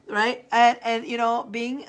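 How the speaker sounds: normal talking voice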